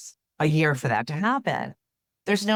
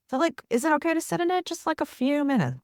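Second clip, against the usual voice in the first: much higher, gentle coaxing tone; soothing therapist voice; 10 semitones higher than usual. Retold or imitated. Therapist soothing a tearful client, high and gentle.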